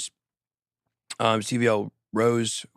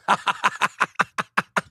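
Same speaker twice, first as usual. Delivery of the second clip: long run of evenly spaced, high ha-has; machine-gun cackle; staccato laugh; 6 semitones higher than usual. Acted. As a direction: laugh in rapid, separate, higher-pitched bursts. Mock laugh after teasing Sam.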